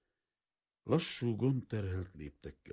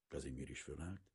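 ordinary delivery, nothing marked

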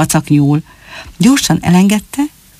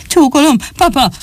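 called out higher after running; breathless call; 8 semitones higher than usual. Acted. Fox cub bursts home greeting his father, breathless call. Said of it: "kiáltotta a futástól kifulladva".

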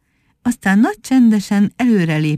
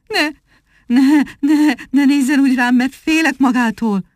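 trembling voice; 6 semitones higher than usual. A narrator's reading. Frightened monkey pleading with the rabbit, trembling. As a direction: beg him not to look, quivering. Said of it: "remegő hangon megszólalt".

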